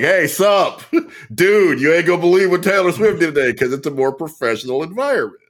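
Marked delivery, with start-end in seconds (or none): 0.0-3.5 higher, lightly hammed-up casual reporter; mock a TV reporter gushing like a buddy; slangy TV reporter. Reporter on Extra dishing [name] gossip, mock slangy.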